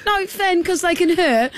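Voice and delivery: high pleading, mock frightened; hammed-up fear of flying toys; scared. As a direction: beg him to stop, half laughing. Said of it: "I was honestly scared".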